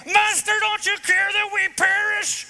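high, loud, frantic plea; startled panicked cry. Retold or imitated. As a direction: jolt him awake in terror. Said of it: "We're talking shaken awake".

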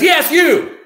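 high, loud, pointed yell; imitated upset shout. Upset lady pointing out a waitress, imitated shout. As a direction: imitate a customer singling someone out. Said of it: "very upset with".

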